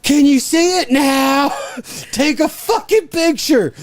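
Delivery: high, slow, loud taunting shout; taunting yell. Retold or imitated. Driver taunting the guard, yelling high and slow. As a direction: yell back a taunt while waving. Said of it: "I'm yelling and I'm waving".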